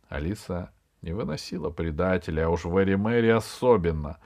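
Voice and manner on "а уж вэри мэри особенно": ordinary delivery, nothing marked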